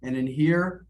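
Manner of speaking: usual speaking voice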